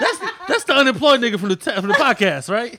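loud and high, amused; excited laughing. Real, not acted. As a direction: laugh out the realisation. Laughing recognition of someone.

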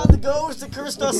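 loud, high mock panic; excited shouting. Real, not acted. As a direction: shout it like a curse struck. Mic falls, blame the ghost.

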